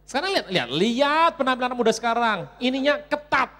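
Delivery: loud, high-pitched exclaiming; comic rant. Acted. Preacher ranting about young people's tight clothes, loud exclaiming. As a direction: rail at the youth fashion.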